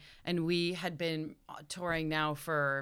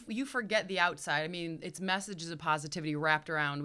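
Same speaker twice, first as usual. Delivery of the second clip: normal talking voice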